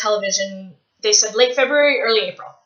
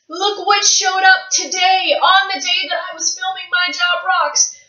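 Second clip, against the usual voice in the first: high, louder, gushing over the book; thrilled showing off; 8 semitones higher, 3 dB louder than usual. Real, not acted. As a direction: show the book off, beaming. Showing off her new book.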